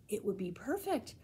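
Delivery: ordinary delivery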